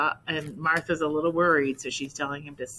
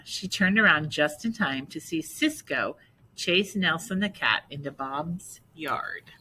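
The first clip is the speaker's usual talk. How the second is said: ordinary delivery, nothing marked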